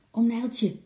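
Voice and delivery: sudden urgent call. A narrator's reading. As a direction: call out to get his attention. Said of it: "riep Lotje opeens".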